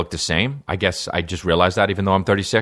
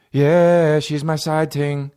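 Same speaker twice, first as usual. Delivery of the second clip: high, drawn-out mock Jamaican accent; 8 semitones higher, much slower than usual. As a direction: put on a slow fake Jamaican accent. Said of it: "like, with a Jamaican accent".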